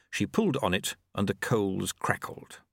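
usual speaking voice

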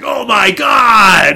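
slow, high, hammed-up yell; mock shout. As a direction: bellow it like a rock star posing. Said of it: "[name]'s like".

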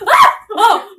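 sudden high loud shriek; startled yelp. Retold or imitated. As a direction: yelp as the bucket drops. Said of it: "all of a sudden we go".

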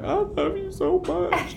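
put-on very high, soft baby-talk coo; high squeaky baby voice to his chihuahua; cooing to the dog. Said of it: "And I was like".

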